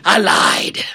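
whispered, sly admission; hushed, gloating tiger aside. Acted. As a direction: breathe it like a secret.

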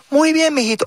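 a hint of an old abuela cooing; lightly voiced grandma. Grandma blessing the boy.

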